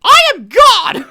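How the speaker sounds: grand squeaky falsetto decree; Mickey Mouse. Pretend. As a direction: boom it out like a squeaky cartoon god. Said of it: "this one as Mickey first".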